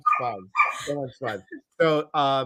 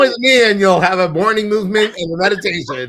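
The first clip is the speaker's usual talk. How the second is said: louder, higher, fast mock inviting call; hammed-up invitation; 8 semitones higher, 9 dB louder, faster than usual. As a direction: ham up the joke invitation. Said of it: "I was like".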